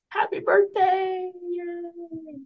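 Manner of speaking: high, drawn-out celebratory cheer; birthday cheer. Real, not acted. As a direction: squeal out a long birthday wish.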